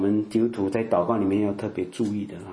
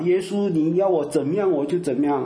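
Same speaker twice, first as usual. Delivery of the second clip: raised, louder pledge of devotion, tearful mock zeal; higher and louder, gushing vow to Jesus; mock fervent convert; 7 semitones higher, 4 dB louder than usual. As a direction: ham up a teary young believer's vow. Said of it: "一把鼻涕一把眼泪".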